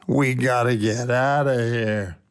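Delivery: low, slow group moan. A narrator's reading. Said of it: "together we moaned".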